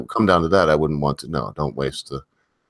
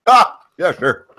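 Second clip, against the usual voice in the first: laughing; 4 semitones higher, 4 dB louder than usual. Real, not acted. Reacting with a laugh.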